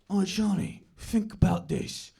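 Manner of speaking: slow, weighty dad impersonation; mock-philosophical East London dad; would-be profound dad. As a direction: say it like a deep observation about life. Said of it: "things that he thinks are deep observations".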